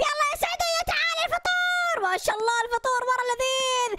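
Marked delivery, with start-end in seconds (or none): 0.1-2.0 a high shouted summons; calling out. Pretend. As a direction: call Saadia down to eat. Calling Saadia to breakfast. (نداء عالٍ لسعدية لتنزل للفطور)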